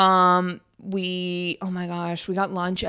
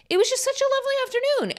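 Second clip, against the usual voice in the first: much higher, quick and gushing; 3 dB quieter, faster than usual. Real, not acted. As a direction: coo it out in a high voice. Gushing about a lovely afternoon.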